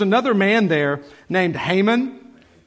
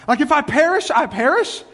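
excited echo; 9 semitones higher than usual. Real, not acted. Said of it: "Isn't that awesome".

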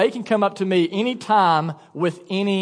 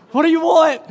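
high, loud mock-startled demand; acted alarm; 9 semitones higher, 6 dB louder than usual. Parent to a child at the bedside, comically alarmed. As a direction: demand it as if jolted awake, hammed up.

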